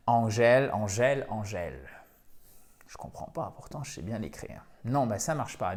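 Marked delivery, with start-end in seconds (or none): 0.1-1.9 ANGÈLE said the French way; mispronounced angel. Retold or imitated. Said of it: "parce que vous dites". 2.9-4.6 soft, muttered bewilderment; puzzled learner. Retold or imitated.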